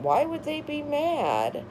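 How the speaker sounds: normal talking voice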